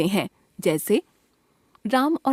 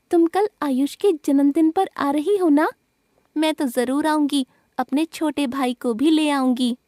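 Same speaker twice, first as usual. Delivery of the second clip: lightly voiced girl; 5 semitones higher than usual. Example dialogue between two girls.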